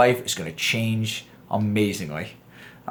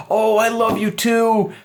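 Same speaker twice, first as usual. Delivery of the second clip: high, slow, syrupy lovesick reply; cheeseball lover; 6 semitones higher, slower than usual. Retold or imitated. Mocking the cheesy guy Han Solo could have been, syrupy and slow. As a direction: ham up the sappy boyfriend line. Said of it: "expecting him to be cheeseball".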